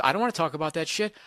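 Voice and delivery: ordinary talk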